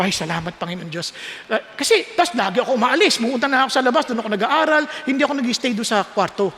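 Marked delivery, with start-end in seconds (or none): 0.0-1.1 softer, lower murmured prayer of relief; quiet relieved thanks. Retold or imitated. Preacher retells thanking God quietly after roommates moved in. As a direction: breathe out a hushed thank-you to God. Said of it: "So, sabi ko".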